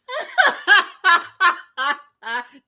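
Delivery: loud rising laugh; burst of laughter. Real, not acted. Cracking up at Harriet's comparison, loud laugh. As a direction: break out laughing at the line.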